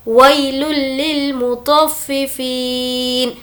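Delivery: unhurried tajweed chanting of वैलुल लिल मुतफ्फिफीन; slow Quran recitation. Shown as a demonstration. Teacher reciting the full verse for students.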